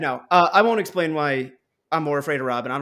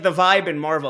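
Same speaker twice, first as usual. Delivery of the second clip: ordinary talk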